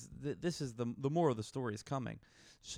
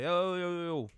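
cocky greeting; 6 semitones higher than usual. Retold or imitated. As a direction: come in hot with a swaggering yo. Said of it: "And I said".

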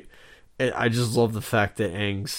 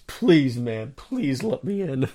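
mock begging; 4 semitones higher, 3 dB louder, slower than usual. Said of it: "My hands and knees".

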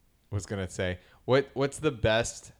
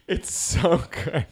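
laughing; 7 semitones higher, 3 dB louder, slower than usual. Real, not acted. Laughing after quoting line.

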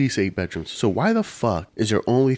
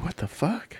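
stunned disbelief; 9 semitones higher, 7 dB quieter than usual. Retold or imitated. Mimics viewer's stunned reaction.

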